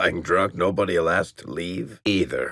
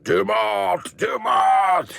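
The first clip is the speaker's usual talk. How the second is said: high, hammed-up monster yell of the name; mock darkspawn bellow; 4 dB louder, much slower than usual. Mocking a darkspawn for friends, hammed-up bellow. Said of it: "I'm a darkspawn".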